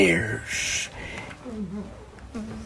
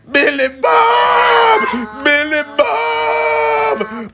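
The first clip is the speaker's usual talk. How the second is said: much higher, slow drawn-out yell; 10 semitones higher, much slower than usual. Acted. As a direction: call out to him across the zoo. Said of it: "People were yelling".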